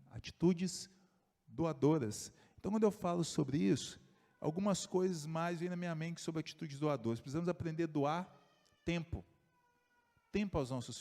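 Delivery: loud, pressing sermon voice; emphatic preaching. Acted. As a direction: drive the point home to the congregation. Preaches with force.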